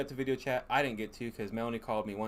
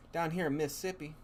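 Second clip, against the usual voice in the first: mock Southern accent; 6 semitones higher than usual. Husband mimicking Mississippi callers, light Southern accent. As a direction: say it like a Mississippi local. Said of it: "That's what they say".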